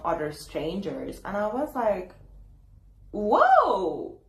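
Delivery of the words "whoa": taken aback. Retold or imitated. Reacting to friend mocking her before strangers, taken aback. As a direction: draw out a stunned reaction, raised in pitch. Retold shock at being mocked.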